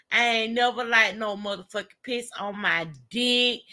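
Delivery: ordinary talk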